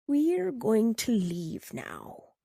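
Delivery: drawn-out lioness voice. Acted. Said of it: "the lion explained to them".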